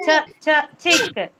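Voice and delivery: two short "Ch" sounds, then "chick"; first sound isolated. Shown as a demonstration. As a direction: tap "Ch, ch" apart before a crisp "chick". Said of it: "you can hear the ch sound".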